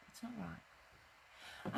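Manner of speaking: whispered slowly, under breath; whispered aside. Real, not acted.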